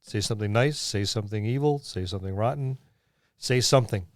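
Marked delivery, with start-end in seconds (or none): none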